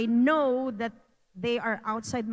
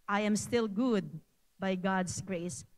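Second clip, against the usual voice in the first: usual speaking voice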